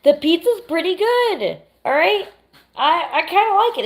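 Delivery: high, insistent playful defense; excited. Real, not acted. Defending her guilty pleasure.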